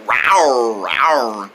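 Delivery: low, smooth come-hither purr; flirty tiger. Pretend. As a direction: croon it like an amorous big cat. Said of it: "I'm falling in love with somebody".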